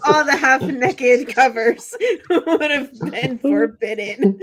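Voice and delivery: slow words through laughter; laughing. Real, not acted. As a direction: talk while laughing hard. Laughing at forbidden covers.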